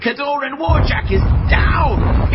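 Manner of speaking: high, loud triumphant yelling; victorious commentator shouting; excited shout. Real, not acted. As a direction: roar the result to the crowd. Warjack destroyed, crowd roaring.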